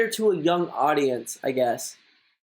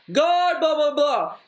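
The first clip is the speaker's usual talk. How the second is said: high, projected preacher imitation; mock preacher; 8 semitones higher, slower than usual. Imitating the pastor preaching about God, mock sermon. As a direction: imitate a preacher holding forth. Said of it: "the preacher will preach about God".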